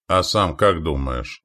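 plain reading voice

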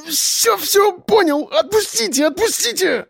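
very high pleading whine; singsong whine. A narrator's reading. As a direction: squeal for mercy in pain. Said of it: "пропел он".

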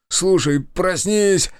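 high, loud wail; howled plea. A narrator's reading. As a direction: howl at them to wake up. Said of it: "взвыл тот".

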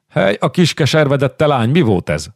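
put-on a real shout at the wild girl; angry shout. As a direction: yell to stop her struggling. Hunyadi restraining Giulietta, yelling.

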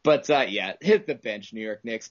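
usual speaking voice

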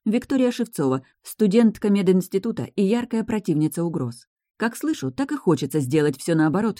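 ordinary delivery

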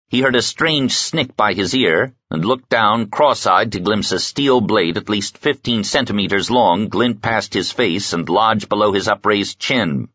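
ordinary delivery

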